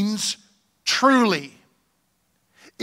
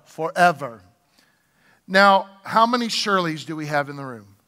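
usual speaking voice

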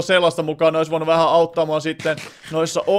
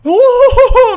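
very high, loud ohohoho laugh; delighted laugh; 11 dB louder, slower than usual. Real, not acted. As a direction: burst out laughing in disbelief. Amazed at lucky spawn. (ihastunut nauru)

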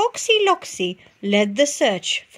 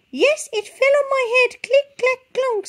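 high, loud chick; squeaky and urgent; 4 dB louder than usual. A narrator's reading. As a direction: chick tells the duck, squeaky. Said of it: "said Chicken Licken".

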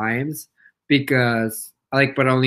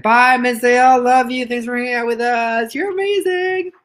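high singsong goodbye; gushing farewell; 8 semitones higher, 3 dB louder than usual. Real, not acted. Sending off a viewer to work, gushing. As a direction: call it out, climbing higher.

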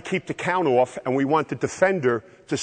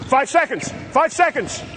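calling from far away; 11 semitones higher than usual. Real, not acted. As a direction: yell it to players across the court. Coach calling count across court.

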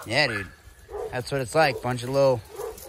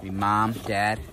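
ordinary talk

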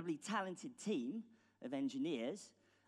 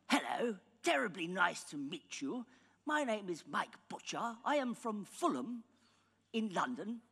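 higher, louder, hammed-up upper-class English accent; mock posh British; 8 semitones higher, 6 dB louder than usual. British founder pitching to Silicon Valley VCs, hammed-up posh English accent. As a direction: send up a stuffy posh British gent with a plummy English accent. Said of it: "and go".

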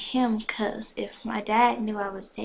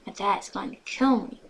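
ordinary delivery

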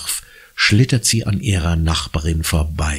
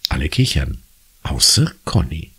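plain reading voice

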